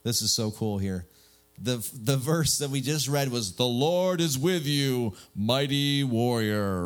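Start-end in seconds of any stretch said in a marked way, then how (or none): 3.5-6.8 slow, grand biblical proclamation; stately, drawn-out movie-prophet voice; Charlton Heston. Acted. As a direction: proclaim it like an epic film patriarch. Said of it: "in a nice Charlton Heston voice".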